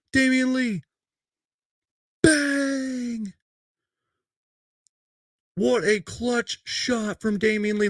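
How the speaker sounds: excited play-by-play, pitched well up, with a shouted bang; high, hyped broadcast voice walking through the replay; play-by-play call. Acted. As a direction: narrate the replay like a hyped commentator.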